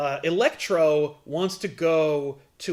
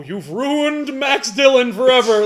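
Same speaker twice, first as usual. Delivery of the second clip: slow, mildly hammed-up fan wail; mock internet outrage; outraged fan; 6 semitones higher, 7 dB louder, slower than usual. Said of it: "And it's like".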